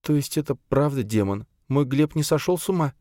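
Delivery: high, pleading grieving mother. Acted.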